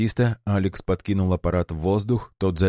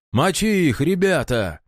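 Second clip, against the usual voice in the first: high rallying yell; battle cry; 10 semitones higher, 3 dB louder than usual. A narrator's reading. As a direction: charge them, screaming. Rebel rallying attack.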